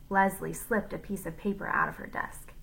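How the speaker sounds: ordinary delivery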